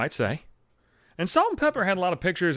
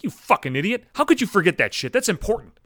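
acted self-scolding, rising higher; higher-pitched, exasperated telling-off; pissed at himself; 6 semitones higher than usual. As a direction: scold yourself in mock anger. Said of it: "I get so pissed at myself".

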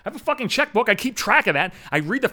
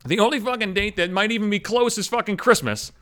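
usual speaking voice

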